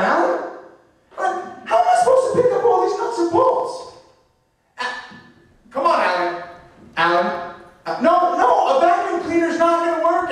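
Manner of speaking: very high, fast, exasperated whining at Alan; a flustered inventor squealing complaints at his helper; shrill panicked falsetto rant about the mess; frantic vacuum inventor. Acted. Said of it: "this is my impression of the guy who invented that vacuum cleaner".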